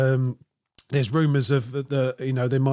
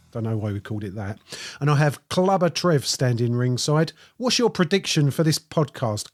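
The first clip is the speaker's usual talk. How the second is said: normal talking voice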